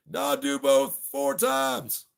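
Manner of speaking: high, loud, slow command; drill sergeant order. Pretend. Drill sergeant commanding the marchers, loud and slow. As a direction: bark the order at the troops. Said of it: "drill sergeant".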